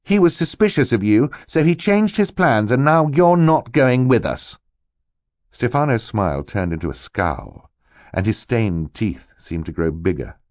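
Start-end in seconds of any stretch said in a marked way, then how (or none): 0.0-4.6 pitched high and loud, victorious; triumphant. A narrator's reading. Boy telling the villain he is not coming, triumphant. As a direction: drive the victory home. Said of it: "Klaus replied triumphantly".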